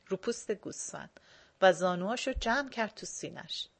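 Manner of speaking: ordinary delivery, nothing marked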